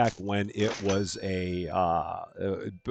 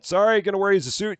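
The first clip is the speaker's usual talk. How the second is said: quick excited apology; 10 semitones higher, 5 dB louder, faster than usual. Real, not acted. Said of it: "Gonna step right in".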